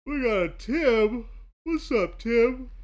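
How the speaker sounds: greeting drawn out through a yawn; slow, high yawn while talking. Real, not acted. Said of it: "Got that yawn".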